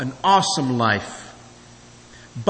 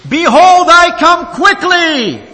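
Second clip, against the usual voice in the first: much higher, loud, slow proclamation; vehement shout; 14 semitones higher, 9 dB louder, slower than usual. Acted. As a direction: thunder the verse out, slowly. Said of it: "repeats his text with more vehemence".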